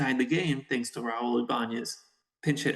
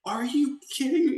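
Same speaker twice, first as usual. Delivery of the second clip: high, louder incredulous cry; 13 semitones higher, 4 dB louder than usual. Retold or imitated. Benji in front of the dad, shocked, high and louder. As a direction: cry out in disbelief.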